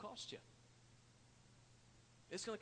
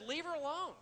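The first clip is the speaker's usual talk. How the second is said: Jesus's command, pitched higher and raised; stern rebuke; 10 semitones higher, 4 dB louder than usual. Retold or imitated. Jesus rebuking Judas's griping, a stern raised command. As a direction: cut him off firmly, defending her.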